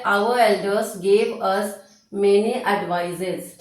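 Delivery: very slow, word by word dictation; spelled-out classroom dictation. Shown as a demonstration. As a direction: read it out slowly for students to copy. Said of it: "dictating the example sentence slowly".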